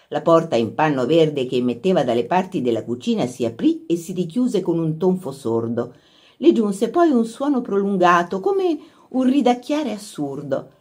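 ordinary delivery

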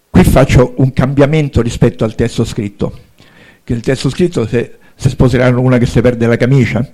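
usual speaking voice

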